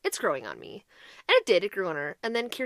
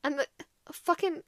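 normal talking voice

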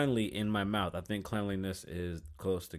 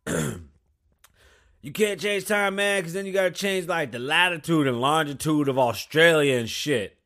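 loud mock rant; 5 semitones higher, 7 dB louder, slower than usual. Voicing the other guy, ranting.

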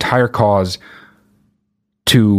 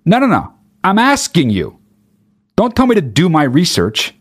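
high, exasperated pushback; insist, fed up, pitch climbing high; exasperated retort; 7 semitones higher than usual. Retold or imitated. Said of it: "it's just like".

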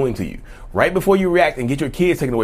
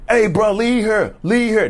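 higher-pitched, drawn-out intervening bystander; bystander stepping in; 7 semitones higher, 3 dB louder, slower than usual. Acted. Bystander telling a man to leave her alone, raised-pitch protest. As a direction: call out to make someone back off. Voicing a bystander confronting you.

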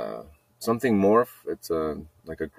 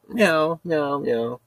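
higher-pitched meows in reply; cat meowing; 6 semitones higher, much slower than usual. Retold or imitated. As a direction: answer as the cat. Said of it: "the cat comes meowing to me".

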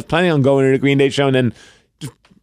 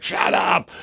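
higher-pitched, held-back mock yell; retold exasperated yell; 6 semitones higher, 4 dB quieter than usual. Dad retelling snapping at his screaming child, mock exasperated yell. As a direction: imitate yelling, pitched up but not loud. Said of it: "she's yelling louder than I am".

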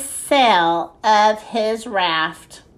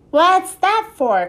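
higher, drawn-out called question; calling out; 8 semitones higher than usual. A narrator's reading. Ziggy to Ned about the chimney grate, calling out. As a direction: call it over, pitched up and slow. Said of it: "called Ziggy".

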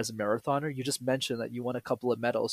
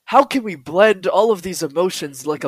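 hammed-up pitch; 5 semitones higher, 12 dB louder than usual. Mock hammed podcast pitch.

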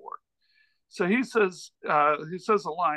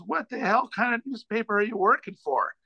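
amused, incredulous comic impression, pitched up; higher put-on voice, mock disbelief; Norm Macdonald; 5 semitones higher than usual. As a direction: imitate a comedian laughing in disbelief at someone. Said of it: "he's just laughing at me".

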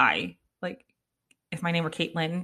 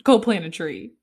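louder teasing command; teasing order; 9 dB louder than usual. Retold or imitated. Wife teasing husband about his carbon footprint, loud mock command. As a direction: tell him off, loudly, to go plant a tree. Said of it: "teasing husband about carbon footprint".

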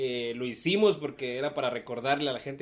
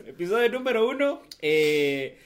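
ordinary talk, nothing marked

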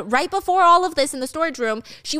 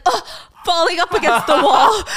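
pained grunt; 7 semitones higher, 7 dB louder than usual. Retold or imitated. Man thrown against wall, panting.

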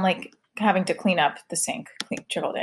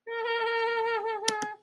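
long high-pitched laugh; laughter; 8 dB quieter than usual. Real, not acted. Mom amused after talking about her daughter and dating, laughing. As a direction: laugh high and steady at your own remark.

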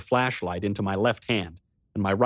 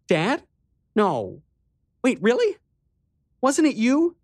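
put-on high girl's voice, puzzled; a girl doubting her memory; confused little sister; 13 semitones higher, 4 dB louder than usual. A narrator's reading.

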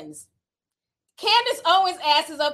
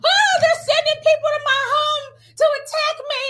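high, loud hammed-up wail; mock crying; 13 semitones higher, 5 dB louder than usual. Mimicking a woman crying to her camera phone, mock wailing. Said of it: "she starts crying".

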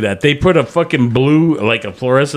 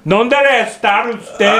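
slow, higher Spanish in a put-on Indian accent; mock Indian-accented Spanish; 7 semitones higher, much slower than usual. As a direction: speak the Spanish slowly with a heavy Indian accent. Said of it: "gets on and goes".

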